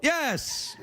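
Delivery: usual speaking voice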